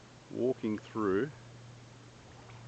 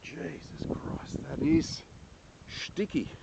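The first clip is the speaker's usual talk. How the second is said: strained whisper; 7 semitones higher, slower than usual. Real, not acted. Stuck in sticky mud.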